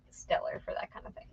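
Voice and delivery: whispered aside, unvoiced; hushed confiding whisper. Real, not acted. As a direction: drop to a secret whisper.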